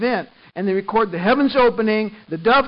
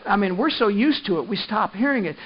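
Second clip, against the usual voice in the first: ordinary talk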